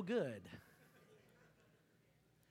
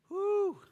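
sharp whoo, pitched up; preacher exclaiming whoo from the pulpit; loud high whoo; 12 semitones higher, 6 dB louder than usual. Real, not acted. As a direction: let out a high whoo of amazement.

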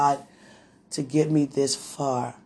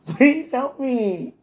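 slow, pleading words through giggles; laughing fit while begging for help; giggling helplessly; 3 semitones higher, slower than usual. Real, not acted. Overcome with holy laughter, begging the Father for help. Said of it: "The more I laugh, the more high I get".

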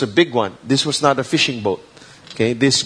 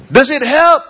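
normal talking voice

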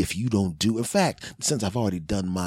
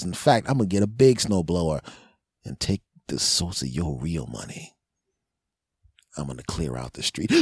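usual speaking voice

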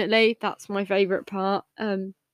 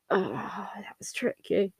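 whisper; 9 dB quieter, slower than usual. Real, not acted. Whispered musing.

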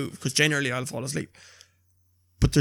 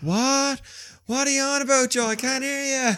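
confused wife; 10 semitones higher than usual. Retold or imitated. Imitating wife's confused reply.

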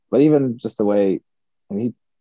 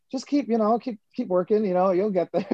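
higher, breezy brush-off encouragement; a hint of a dismissive booker, pitched up; lightly voiced booker; 14 semitones higher than usual. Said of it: "He goes".

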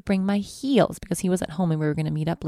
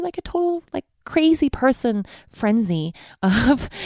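normal talking voice

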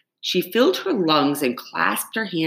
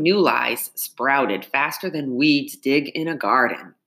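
plain reading voice

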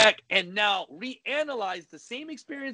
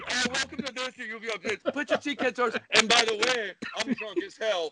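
ride attendant voice; 3 semitones higher than usual. Pretend. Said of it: "you used to work at Six Flags".

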